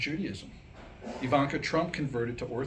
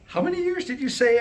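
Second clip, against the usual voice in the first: put-on higher, louder alarmed old woman; alarmed old lady; 10 semitones higher, 7 dB louder than usual. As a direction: gasp out the question in panic. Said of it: "asked Missus Goldberg".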